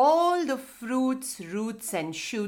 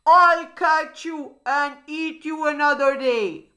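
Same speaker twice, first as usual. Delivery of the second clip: raised, higher voice of a thwarted crocodile; angry yell; 4 semitones higher, 6 dB louder than usual. A narrator's reading. Crocodile to the escaping mouse deer, angry yell. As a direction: yell a storybook threat. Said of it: "The angry crocodile yelled".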